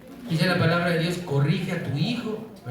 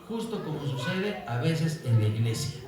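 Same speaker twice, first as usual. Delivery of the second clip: normal talking voice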